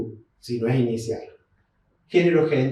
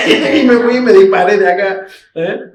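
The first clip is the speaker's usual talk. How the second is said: higher and much louder, joking about running off; playful loud joke; 5 semitones higher, 10 dB louder than usual. Shown as a demonstration. Teacher joking loudly about fleeing the class. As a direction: burst out with a joke about leaving. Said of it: "Fui, me fui".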